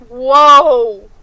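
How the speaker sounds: very loud, drawn-out whoa; booming exclamation of surprise; amazed shout. Real, not acted. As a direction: blurt it out astonished. Reacting to many bits.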